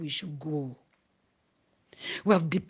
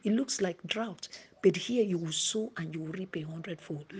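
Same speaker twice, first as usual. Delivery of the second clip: usual speaking voice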